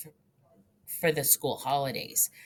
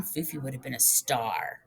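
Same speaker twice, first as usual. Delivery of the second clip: ordinary delivery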